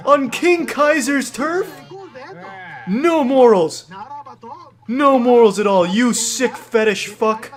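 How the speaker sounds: high, drawn-out indignant commentary; hammed-up scandalized commentator, stretching the words; mock outrage. Commentator scolding a robot for going too far, mock outrage.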